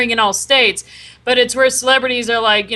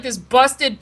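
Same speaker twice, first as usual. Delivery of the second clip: usual speaking voice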